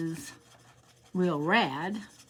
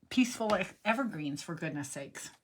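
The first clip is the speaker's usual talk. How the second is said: usual speaking voice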